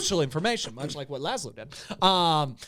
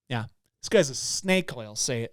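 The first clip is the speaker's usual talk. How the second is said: ordinary delivery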